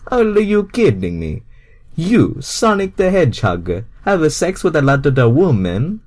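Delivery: Mario can't believe it; disbelieving Mario. Acted.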